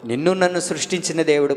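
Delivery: usual speaking voice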